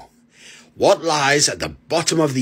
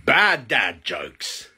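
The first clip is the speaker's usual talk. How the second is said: normal talking voice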